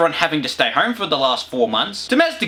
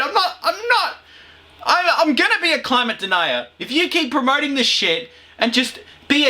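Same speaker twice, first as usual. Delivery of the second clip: much higher, hammed-up angry bloke; mock climate denier; 10 semitones higher than usual. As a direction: play a petulant denier.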